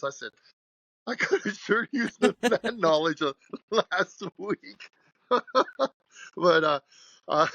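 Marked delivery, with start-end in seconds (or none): 1.1-5.4 slow and higher, laughing mid-line; laughing through it. Retold or imitated. Telling the Sam's clerk he needed it last week, laughing. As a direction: chuckle the irony out.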